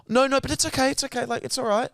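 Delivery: high-pitched hurt bride insisting it's fine; bride's voice. Retold or imitated. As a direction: mimic the bride brushing it off nervously. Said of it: "and the bride goes".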